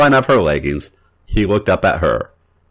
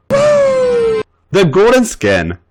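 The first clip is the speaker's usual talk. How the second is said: high drawn out; much slower than usual. A narrator's reading.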